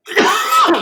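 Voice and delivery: hard cough from getting hyped; one loud sharp cough; coughing fit. Real, not acted. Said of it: "Sorry, I'm coughing so much".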